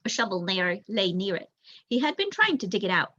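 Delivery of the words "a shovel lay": ordinary delivery, nothing marked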